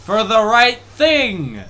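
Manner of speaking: slow loud emphatic exclaiming; excited shout. Real, not acted. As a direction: proclaim it loudly. Excited gamer explaining.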